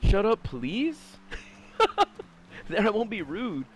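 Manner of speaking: very slow, sarcastic "please"; drawn-out mock plea. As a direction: stretch it out with fake politeness. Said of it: "And it's like".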